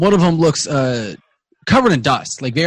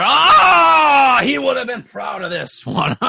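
high, roaring dwarf cheer; standard dwarf voice; 10 semitones higher, 5 dB louder, slower than usual. Acted. Dwarves cheer as the mug is slammed, high shout. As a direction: roar in delight as a rowdy dwarf. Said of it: "It's my standard dwarf voice, guys".

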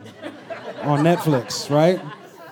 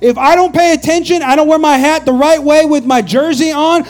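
loud, high, hammed-up sports-fan panic; mock desperation, climbing to a yell on the last words; stressed-out pleading; 6 semitones higher, 6 dB louder than usual. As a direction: plead frantically with your wife, building to a shout. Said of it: "I'm stressed".

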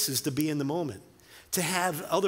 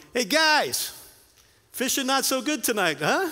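high, loud friendly hail across the water; a teasing shout from the shore; calling out to the boat; 8 semitones higher, 4 dB louder than usual. Retold or imitated. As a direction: call out to the fishermen, pitched up and loud. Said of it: "Jesus yells out to them".

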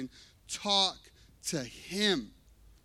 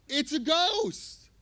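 scared cry; 9 semitones higher, 4 dB louder, slower than usual. Acted. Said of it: "So they cry out".

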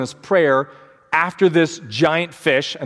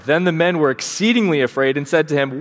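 ordinary talk

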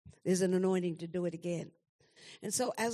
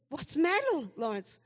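retold alarmed question, much higher and louder; alarmed mother; 6 semitones higher, 5 dB louder than usual. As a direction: replay the jolt of fear at the phone call. Mother answers her sobbing son.

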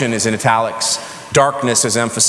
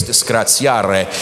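slow, lower Italian "disgraziare"; Italian grandparents; 3 semitones lower, slower than usual. Retold or imitated. Preacher echoing Italian grandparents-in-law, slow Italian accent. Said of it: "they would always say disgrace".

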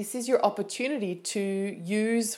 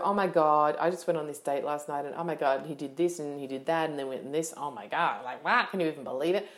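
oversharing gossip; 5 semitones lower, faster than usual. Retold or imitated. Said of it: "I'm not gonna sit there and go".

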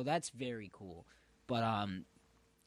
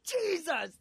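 startled yelp; 4 dB louder than usual. Retold or imitated. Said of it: "He's like".

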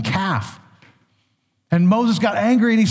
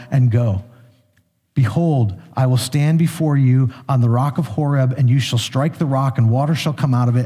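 lower, measured Bible reading; scripture reading; 5 semitones lower than usual. Acted. Pastor reading Exodus to the congregation, lower and measured. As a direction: read the passage with weight.

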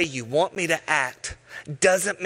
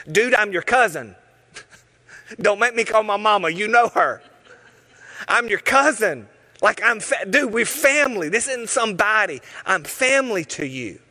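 raised, insistent plea, higher throughout; playfully pleading with family, pitched up; pleading cousin; 6 semitones higher, 3 dB louder than usual. Retold or imitated. John begging his cousin Jesus from the dungeon, higher insistent plea.

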